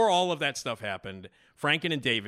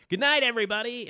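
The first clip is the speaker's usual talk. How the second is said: higher, louder showman's sign-off; cheery vaudeville goodnight; 8 dB louder than usual. Retold or imitated. Host imitating the duo's closing wave, bright mock showman call. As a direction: wave goodbye to the crowd like a vaudeville duo. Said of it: "at the end, it's always waving".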